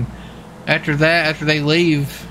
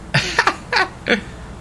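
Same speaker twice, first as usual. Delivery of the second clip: breathless laugh; 4 dB quieter than usual. Real, not acted. Laughing at partner on radio.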